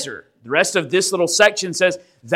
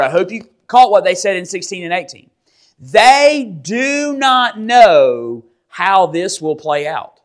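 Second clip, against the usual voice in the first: ordinary talk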